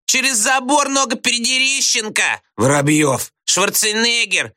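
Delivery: high, clowning name-calls; yelled out. A narrator's reading. Classmates shout false names at the teacher, yelling. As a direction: pitch each silly name up like a heckler. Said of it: "закричали Слушкину".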